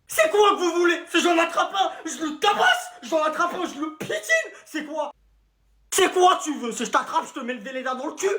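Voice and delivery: high-pitched, cracked ranting; hammed-up teacher meltdown; hysterical threats. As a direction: rant at the class in a squeaky fury.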